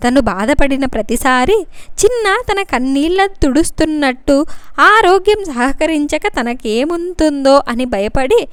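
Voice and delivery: usual speaking voice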